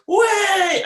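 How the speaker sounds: high, loud excited cry of Way; mock mum whooping with glee; retelling mum's sudden loud whoop. Mum's sudden exclamation retold.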